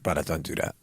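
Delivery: quietly begging in your head; low, soft inner "Brother, don't do that"; inner pleading. Retold or imitated. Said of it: "In my head, I was like".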